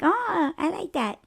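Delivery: high, soft cooing quote; admiring approval. Retold or imitated. People liking her name, high soft approval. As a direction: coo it softly and high. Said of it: "they're like".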